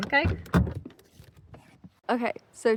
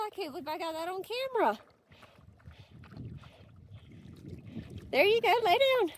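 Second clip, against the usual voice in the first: praising the dog; 8 semitones higher than usual. Real, not acted. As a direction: coo at the dog like a proud owner.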